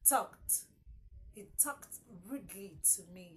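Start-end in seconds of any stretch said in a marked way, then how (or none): none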